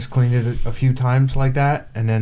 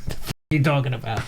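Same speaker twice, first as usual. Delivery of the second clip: rushed exasperation; much faster than usual. Retold or imitated. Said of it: "I'm like".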